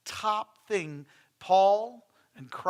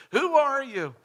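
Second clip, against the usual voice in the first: teasing grandpa; 8 semitones higher, 8 dB louder than usual. Retold or imitated. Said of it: "I said".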